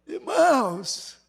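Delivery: high, crying appeal to the church; preacher crying out to the congregation; tearful preaching. Real, not acted. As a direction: plead through tears.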